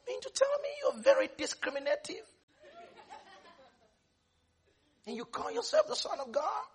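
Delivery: high-pitched incredulous questioning; mock indignant disbelief. Objector challenging Jesus as discriminative, high and incredulous.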